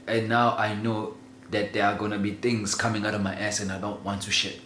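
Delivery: ordinary talk, nothing marked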